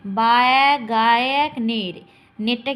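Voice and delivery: loud, drawn-out syllable then its number; slow syllable count. Shown as a demonstration. Teacher counting beats for students, slow loud syllables. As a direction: stretch each syllable as you count it. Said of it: "তখন slow মোশনে পড়বে".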